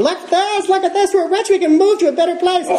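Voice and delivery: high giddy squeal of joy; like a kid who just got money; delighted high-pitched gushing; excited. Retold or imitated. So happy, rich.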